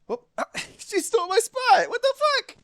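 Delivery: very high, loud laughing squeal; laughing surprise. Real, not acted. Streamer squealing as the cat steals her spot. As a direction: squeal in amused shock.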